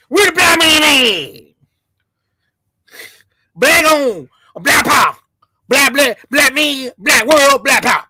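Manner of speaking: hammed-up parody of a rant; mocking imitation. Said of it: "that's how they talk".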